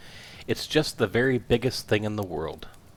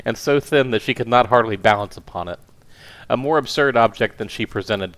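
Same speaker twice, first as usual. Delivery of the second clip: ordinary delivery, nothing marked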